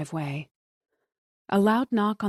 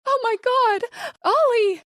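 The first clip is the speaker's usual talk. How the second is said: screaming in horror; 7 dB louder than usual. A narrator's reading. Car explodes, wife screams.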